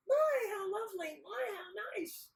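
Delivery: hammed-up sweetness; gushing simpleton. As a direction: praise in a silly high voice. Nasrudin repeats praise to fighters.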